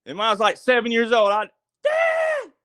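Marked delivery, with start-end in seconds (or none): 1.8-2.5 put-on high, loud childhood yell for dad. Scared child yelling across the field for his dad. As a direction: call out to dad at the top of your lungs. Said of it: "I yelled to the top of my lungs".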